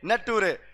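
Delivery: नटूरे said as "na-too-re" with a doubled t; deliberate misreading; mispronounced nature. Shown as a demonstration. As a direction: nature read as spelled, नटूरे. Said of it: "नेचर को बोलते हैं नटूरे".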